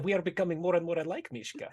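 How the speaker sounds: giggling through the line, fast; amused giggly remark; laughing in character. Acted. Innkeeper teasing Mishka, giggling as she says it.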